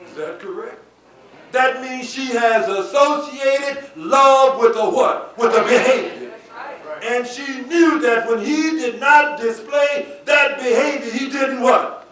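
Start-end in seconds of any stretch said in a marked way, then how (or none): 1.5-12.1 loud, high teaching from the pulpit; forceful preacher's call-and-response; emphatic preaching. Real, not acted. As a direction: drive the point home, calling for the congregation's answer. Preaches to the congregation.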